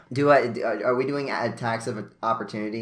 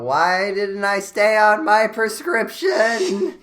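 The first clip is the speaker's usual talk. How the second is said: whiny lament; 10 semitones higher, 7 dB louder, slower than usual. Acted. Character laments, in-character.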